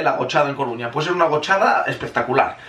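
ordinary talk, nothing marked

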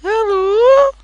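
very high, loud hello; high squeaky rising call; voiced skeleton. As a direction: squeal a greeting like a cartoon creature. Voicing the peeking skeleton.

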